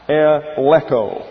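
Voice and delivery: "Eleko" said slowly, each syllable clear; Greek word demo. Shown as a demonstration. Teacher shows the Bible class the Greek word, slow and careful. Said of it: "Here is the word in the Greek".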